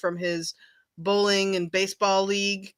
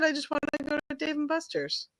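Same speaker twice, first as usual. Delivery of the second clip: high, softer voice; imitated son's request; 7 semitones higher, 6 dB quieter than usual. Son telling mom his party wish, high imitation. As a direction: lightly mimic a kid asking for little, pitched up.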